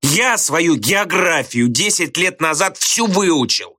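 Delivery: high, slow, emphatic indignation; indignant retort. A narrator's reading. Teacher defends his learning to a pupil, indignantly.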